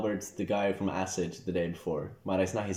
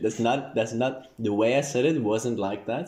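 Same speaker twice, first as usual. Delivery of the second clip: ordinary talk, nothing marked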